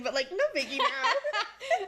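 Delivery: high, slow laughing drawl. Real, not acted. Shrugging off a traumatic divorce, laughing. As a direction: laugh it off lightly.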